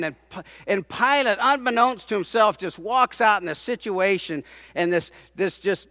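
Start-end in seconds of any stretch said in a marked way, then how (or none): none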